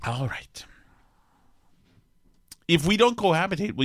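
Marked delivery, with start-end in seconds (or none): 0.1-0.6 whisper. Real, not acted. Quiet aside before the recording starts.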